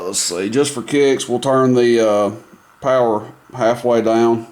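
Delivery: ordinary talk, nothing marked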